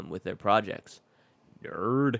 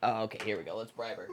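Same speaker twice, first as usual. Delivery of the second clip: normal talking voice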